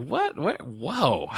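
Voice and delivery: squeaky high surprised what, whoa; shocked what. Retold or imitated. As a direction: retell your own jaw-drop reaction. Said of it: "I was like".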